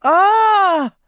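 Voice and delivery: loud, high pained wail. Acted. Maureen wailing after John slaps her. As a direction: let out a long high cry. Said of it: "she wailed".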